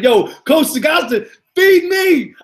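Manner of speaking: excited shout. Retold or imitated. Excited retelling of his own call.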